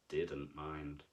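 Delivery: plain reading voice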